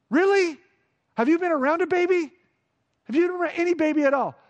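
high, fast mock disbelief; incredulous. Real, not acted. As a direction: needle them, you can't be serious. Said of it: "challenging the congregation".

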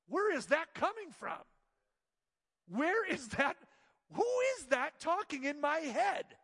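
startled inner question. Retold or imitated. Pastor catching a voice in his head, startled. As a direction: ask it as if catching an intruder.

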